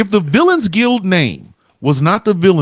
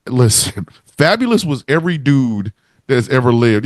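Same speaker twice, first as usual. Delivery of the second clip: ordinary talk, nothing marked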